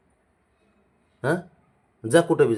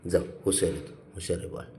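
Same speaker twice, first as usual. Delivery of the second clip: much lower and softer, breathy whisper; tender whisper; 10 semitones lower, 11 dB quieter than usual. Real, not acted. Owner soothing the sulking cat in a soft whisper.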